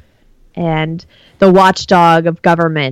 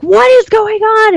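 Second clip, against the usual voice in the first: retold scream; 13 semitones higher than usual. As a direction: shriek it in exasperation at half volume. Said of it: "And I started screaming".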